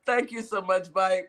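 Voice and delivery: normal talking voice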